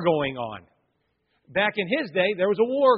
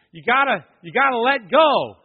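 louder, insistent retold call; 4 semitones higher, 7 dB louder than usual. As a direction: urge the climber from above. Retold shouts from the instructors.

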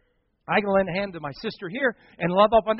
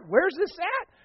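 imitated amazement, pitch shooting way up; astonished reporter, voice leaping upward; incredulous question; 11 semitones higher, slower than usual. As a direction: ask in high-pitched disbelief.

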